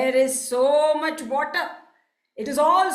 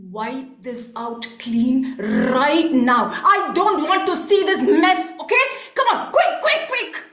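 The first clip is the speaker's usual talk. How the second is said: angry yelling; 4 dB louder than usual. Acted. Merchant orders coolie to clean up, yelling. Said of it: "stormed out of the shop and yelled".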